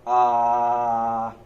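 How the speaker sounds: long, loud open-mouthed ah. Shown as a demonstration. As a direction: open wide and hold a loud ah. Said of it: "Can you open your mouth and say".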